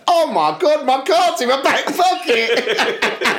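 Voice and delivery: high, drawn-out exclamation of discovery; put-on astonished outburst; mock sudden realisation. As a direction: act out a hammy, high-pitched eureka. Acted sudden discovery of card.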